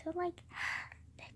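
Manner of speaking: mock delight, breathed out; sarcastic whisper. Real, not acted. Moaning to camera about tea, in a sarcastic whisper. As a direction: whisper "the joy" with dry sarcasm.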